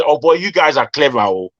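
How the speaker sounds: ordinary talk, nothing marked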